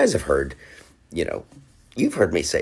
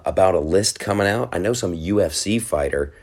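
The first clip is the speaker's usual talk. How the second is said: ordinary talk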